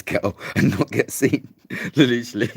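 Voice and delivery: breaking up into high laughter; laughing. Real, not acted. Admitting her sneaky plan to listeners, laughing. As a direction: let the laugh take over the words.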